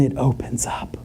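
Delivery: whispered for suspense; breathy hush before the storm; dramatic whisper. Retold or imitated. Telling the congregation how the storm broke, whispered. Storm breaks over wedding.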